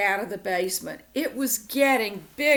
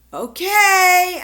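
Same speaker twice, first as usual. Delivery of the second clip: higher, much louder call back; yelling back; 10 semitones higher, 11 dB louder than usual. Acted. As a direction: holler the answer to Mom. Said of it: "I yelled back".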